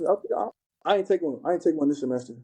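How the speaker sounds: ordinary delivery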